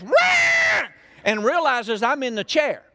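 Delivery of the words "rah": high, loud mock cat screech; cat's startled yowl. Preacher imitates the startled cat landing on him, a loud high screech. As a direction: yowl like a cat caught midair. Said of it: "that cat says".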